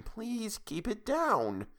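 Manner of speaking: put-on urging quiet so the boy won't wake; hushing. A narrator's reading. Grandmother hushing late-night quarrel.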